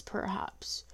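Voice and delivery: barely breathed, voiceless; a fearful whispered aside; hushed perhaps. A narrator's reading. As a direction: let the word slip out as a whisper. Narrator dread, dropped word.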